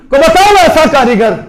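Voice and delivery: loud preaching challenge. Real, not acted. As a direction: hurl the question at the crowd. Challenge repeated loud then hushed.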